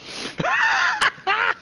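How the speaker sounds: very high squealing laugh; wheezy high cackle, nearly crying; hard laughter. Real, not acted. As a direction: shriek with helpless laughter. Cracking up at victim blaming.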